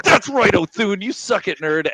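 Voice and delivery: high, loud mock gloat; gloating taunt. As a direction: rub it in like a kid. Gloating at NPC, retold.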